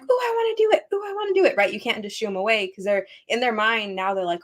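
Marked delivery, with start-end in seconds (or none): 0.0-1.5 put-on high, excited child voice; imitating eager kids. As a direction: mimic toddlers begging to join.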